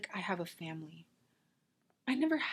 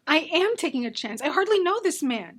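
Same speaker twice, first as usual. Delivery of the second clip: loud, high defensive yell; angry shouting; 7 semitones higher, 10 dB louder than usual. Acted. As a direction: shout back defiantly. Friends' heated quarrel over marriage.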